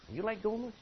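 ordinary talk

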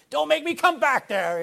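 high, loud shouted warning; a parent's warning shout, loud and high; mock parent yell. Shown as a demonstration. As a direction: call it back over your shoulder like a fed-up parent. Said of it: "you know".

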